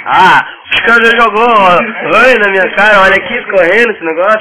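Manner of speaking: loud, high, excited outburst; yelling as the mess hits you; excited shouting. Real, not acted. Toy fight gets messy.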